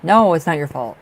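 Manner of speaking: loud emphatic no, comforting her; emphatic reassurance. Real, not acted. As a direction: insist she is not to blame. Reassuring Lupe, then softer.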